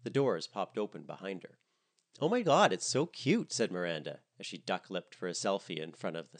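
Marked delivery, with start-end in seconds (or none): 2.2-3.5 high, loud excited gush. A narrator's reading. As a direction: squeal with delight. Miranda gushes at the house.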